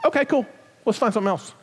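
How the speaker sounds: high, light, easygoing okay cool; cheerful pastor letting a volunteer move on; breezy reassurance. Retold or imitated. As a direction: shrug it off kindly. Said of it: "We're gonna go".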